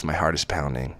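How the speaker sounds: ordinary delivery, nothing marked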